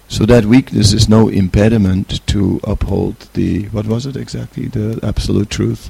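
ordinary talk, nothing marked

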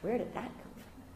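whispered to herself; hushed inner wondering; inner thought. Retold or imitated. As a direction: breathe it as a private doubt. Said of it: "And I thought".

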